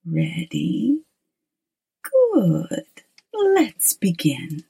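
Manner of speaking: hushed, slow spooky whisper; horror host. Acted. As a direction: whisper like a creepy storyteller host. Horror host introduces the story.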